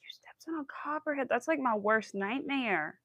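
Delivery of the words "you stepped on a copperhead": breathless shocked whisper; horrified whisper. Real, not acted. Repeating the viewer's copperhead news in a horrified whisper. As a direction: whisper it in disbelief.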